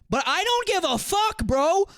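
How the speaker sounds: loud, high outburst; frustrated yelling. Real, not acted. Ranting at chatter R-Wing's logic, high exasperated yell. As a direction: let the anger explode. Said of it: "I'm not yelling at you".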